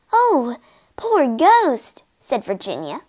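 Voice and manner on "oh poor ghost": slow, tender sympathy; pitying. Acted. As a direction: draw it out with pity. Virginia pities the ghost.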